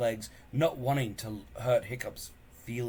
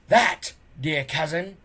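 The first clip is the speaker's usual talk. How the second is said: a loud, high sneer; 5 semitones higher, 9 dB louder than usual. Acted. Bully cousin sneering at Hiccup, loud and high. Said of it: "sneered Snotlout".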